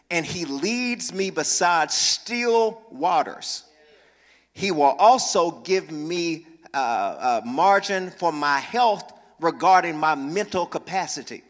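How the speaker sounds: slow, measured reading of the psalm; scripture reading. Acted. Pastor reading the psalm to the church, slow and measured. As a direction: draw out each phrase of the verse.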